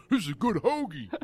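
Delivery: hammed-up Philly guy praising his food; mock Philly sandwich eater. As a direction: imitate a pleased Philly guy, laughter creeping in. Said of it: "these Philly guys".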